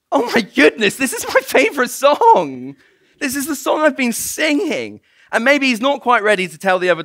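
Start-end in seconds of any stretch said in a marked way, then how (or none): 0.1-5.0 high-pitched, giddy acted excitement; astonished realisation. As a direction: exclaim it high, thrilled by the discovery. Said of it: "And he's thinking".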